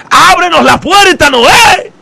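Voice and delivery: high pleading cry of the drowning crowd; desperate shout. Retold or imitated. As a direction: yell it up at the closed door. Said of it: "ese montón de gente gritando".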